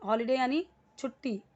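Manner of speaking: usual speaking voice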